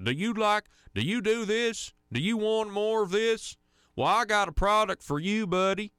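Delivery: high, loud hammed-up Southern accent like a pitchman; mock Southern accent. Radio host mocking national ads with a put-on Southern accent, high and loud. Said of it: "they're doing a Southern accent".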